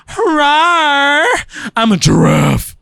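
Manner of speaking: loud high roar then giraffe line; dinosaur voice. Acted. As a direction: roar it out like a silly beast. Character roars then declares.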